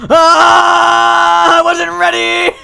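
loud high yelp; startled shout. Real, not acted. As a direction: caught off guard. Caught off guard, shouting.

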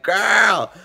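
put-on a loud, high, sassy "Girl". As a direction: throw it at her with full attitude. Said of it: "I'm like".

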